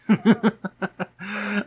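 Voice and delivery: loud, high burst of laughter; amused cackle. Acted. Amused by wife's insult.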